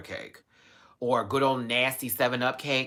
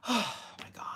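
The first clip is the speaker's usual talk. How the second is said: whisper; 10 semitones higher, 8 dB quieter than usual. Real, not acted. Whispered disbelief.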